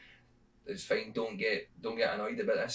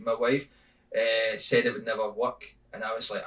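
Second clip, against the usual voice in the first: ordinary talk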